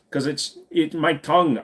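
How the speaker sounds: normal talking voice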